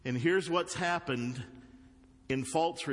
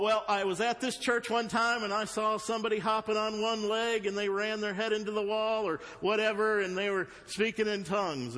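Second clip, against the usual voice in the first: higher-pitched voice of a doubter; objecting churchgoer; 9 semitones higher than usual. Acted. Churchgoer objecting with a wild church story, mock high-pitched voice. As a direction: rattle off a secondhand church story in a raised pitch.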